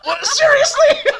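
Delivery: real disbelief after the bomb blows; laughing disbelief. Real, not acted. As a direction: cry it out in shocked laughter. Bomb just exploded.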